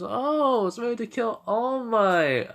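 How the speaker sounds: very high, drawn-out "oh, I see"; hammed-up dawning understanding, slow and high; exaggerated realization. Boy reacts to the villain's boast, exaggerated realization.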